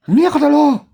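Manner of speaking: high, loud hammed-up surprise; imitating a bystander's loud disbelief; mock shocked outburst. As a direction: blurt it like a stunned onlooker. Onlooker's outburst, retold scene.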